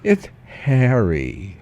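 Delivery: very slow "Harry" with a long open A; drawn-out correction. Shown as a demonstration. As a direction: stretch the A of "Harry" as in "hat". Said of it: "that kind of pronunciation".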